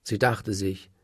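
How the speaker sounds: usual speaking voice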